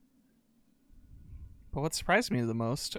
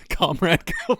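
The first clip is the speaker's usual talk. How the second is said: laughing; 2 semitones higher, 7 dB louder than usual. Real, not acted. Laughing at the comrade greeting.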